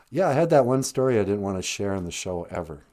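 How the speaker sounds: deep fast put-on voice; low put-on voice. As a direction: drop into a low, quick comic voice. Co-host answers in odd voice.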